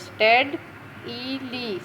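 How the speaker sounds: स्टेड, ई, ली said as three separate beats; syllable by syllable. Shown as a demonstration. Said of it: "तीसरा है, three syllables". (स्टेड ई ली, तीन हिस्सों में तोड़कर)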